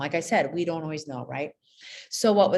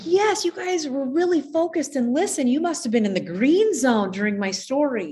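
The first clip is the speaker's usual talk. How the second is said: higher, louder enthusiastic praise; praising teacher; 7 semitones higher, 6 dB louder than usual. Retold or imitated. Teacher praising kids who focused, bright excited praise. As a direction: cheer the class on for listening.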